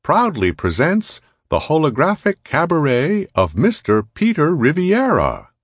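high, loud cabaret announcer voice; showy emcee introduction, high and projected; disembodied announcer. A narrator's reading. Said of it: "said a disembodied voice with a pronounced Sprawl accent".

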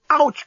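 high yelp of pain; pained cry. Acted. Lucy bitten on the earlobe, pained cry. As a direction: yelp as if suddenly nipped. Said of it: "cried Lucy".

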